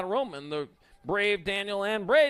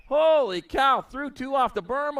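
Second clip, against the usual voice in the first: surprised exclamation; 7 semitones higher, 5 dB louder, slower than usual. Real, not acted. Riders thrown off the berm.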